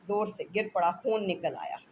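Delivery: usual speaking voice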